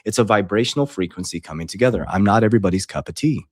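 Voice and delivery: ordinary delivery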